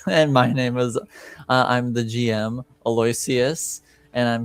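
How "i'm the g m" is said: ordinary talk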